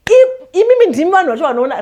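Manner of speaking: high, loud and fast push to the viewers; insisting it is you who watch most; emphatic appeal. Real, not acted. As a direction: drive the point home, rushing.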